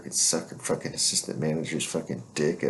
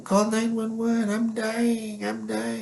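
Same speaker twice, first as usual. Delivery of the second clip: much higher, slow, whiny mock panic; mock panicked screaming; much slower than usual. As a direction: play the junkie begging for help. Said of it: "running around the neighborhood screaming".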